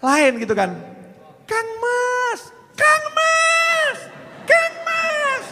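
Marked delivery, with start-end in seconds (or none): 1.5-5.4 very high, tender falsetto call of a sweetheart; calling a lover. Retold or imitated. Preacher imitates a woman calling her beloved, very high falsetto. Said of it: "kalau manggil kekasih kan lain gitu".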